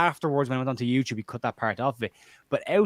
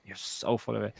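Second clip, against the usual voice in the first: hushed, breathy-free whisper of contempt; whispered retort; 9 dB quieter, slower than usual. Retold or imitated. To a bragging podcaster, a whispered put-down. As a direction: whisper it under your breath. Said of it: "And I'm like".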